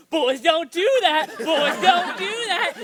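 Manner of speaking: high, loud singsong taunt; teasing mom. Retold or imitated. Mom teasing her son in her room, loud grinning taunt. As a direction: gleefully taunt him, grinning. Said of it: "evil grin on her face and started going".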